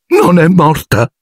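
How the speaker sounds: high, slow, choked disbelief; choked high caretaker's voice. Acted. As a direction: gasp it out, half strangled. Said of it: "ripeté Filch con voce soffocata".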